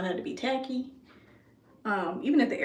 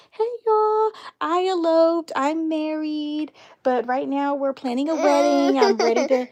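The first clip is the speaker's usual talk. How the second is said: excited announcement; 6 semitones higher, 4 dB louder than usual. Retold or imitated. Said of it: "pop on and be like".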